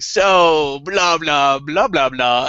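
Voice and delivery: slow, drawn-out blah blah; lazy mock patter; mock smooth talk. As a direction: mimic a rambling smooth talker. Mocking smooth talker.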